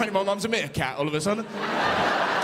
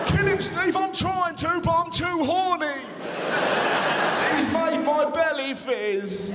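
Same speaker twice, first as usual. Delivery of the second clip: thug; 7 semitones higher, slower than usual. Pretend. Fighters in scene.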